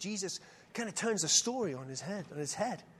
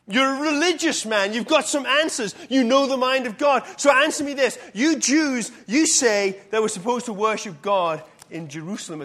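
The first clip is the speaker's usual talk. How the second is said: argumentative diversion; 8 dB louder than usual. Acted. Woman diverts to old argument.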